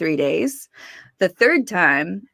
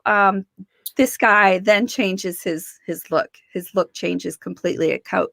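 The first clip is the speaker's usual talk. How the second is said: usual speaking voice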